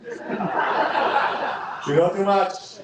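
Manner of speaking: put-on slow, drawn-out Spanish accent through laughter; very slow laughing Spanish accent; dad's Spanish accent. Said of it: "As my dad would say with a Spanish accent".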